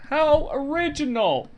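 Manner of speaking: high, slow mock praise; sarcastic. Real, not acted. Host mocking the hero's name, high sarcastic drawl.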